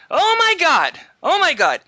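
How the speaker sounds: high-pitched panicked exclamation; mock freak-out. Pilot imitates her freaking out once the engine runs, high mock panic. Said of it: "And she freaks out".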